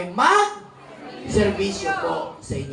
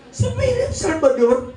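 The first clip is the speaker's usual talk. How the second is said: put-on higher, slow, laughing drunk voice; mock drunk; 11 semitones higher, 5 dB louder than usual. Drunk man teasing the senator about Philip, slow high laughing. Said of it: "Sabi niya".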